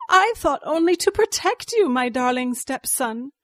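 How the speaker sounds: high, loud protest easing toward the end; pleading. A narrator's reading. Cornered villain pleads with her stepson.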